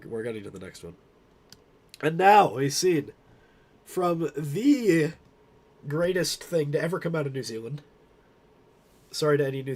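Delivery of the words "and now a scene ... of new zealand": grand announcer. Acted. Grand announcement of next meme.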